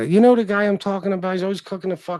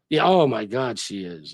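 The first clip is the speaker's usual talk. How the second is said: normal talking voice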